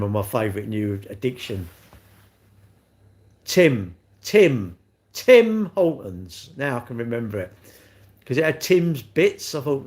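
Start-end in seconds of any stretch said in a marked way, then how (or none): 3.5-6.5 TIM said alone, loud and separate, then drawn out; emphatic repetition. Real, not acted. Speaker drilling a forgotten shop name into memory, emphatic repetition. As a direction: hammer each TIM, stretching the last slowly.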